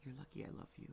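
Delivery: whispered mock threat; loving exasperation. Real, not acted. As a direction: breathe it out, exasperated. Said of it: "talk more into the microphone".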